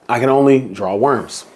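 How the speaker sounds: usual speaking voice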